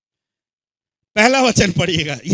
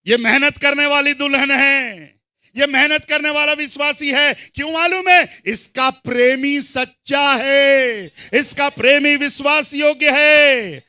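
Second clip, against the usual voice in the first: high, ringing proclamation; forceful preaching; 6 semitones higher, 6 dB louder than usual. Real, not acted. Preacher extols the faithful bride to believers, forceful preaching. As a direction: declare it with sustained full-voiced conviction.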